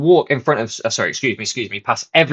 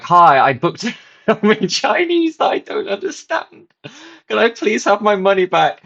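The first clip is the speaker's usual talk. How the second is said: sheepish customer voice; 9 semitones higher than usual. Retold or imitated. Asking the staff for a refund, high sheepish voice. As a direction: play the mortified customer asking nicely.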